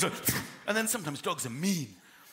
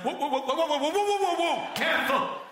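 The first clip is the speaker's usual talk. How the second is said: rising high, louder string of whoas; alarmed owner; 9 semitones higher, 3 dB louder than usual. Retold or imitated. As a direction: pull the dog back in a rising panic. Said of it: "the owner's like".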